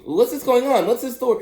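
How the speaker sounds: high-pitched girlfriend imitation; questioning girlfriend. Girlfriend talking through the Thor movie, high mock voice. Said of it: "Don't talk to me".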